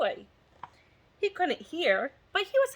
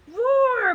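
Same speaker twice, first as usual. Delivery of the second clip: high, loud mock dragon roar; dragon bellow roar; 12 semitones higher, 9 dB louder than usual. Dragon bellowing at the knights, a loud hammed-up roar. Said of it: "bellowed the dragon".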